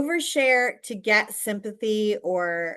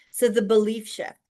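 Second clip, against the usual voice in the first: ordinary delivery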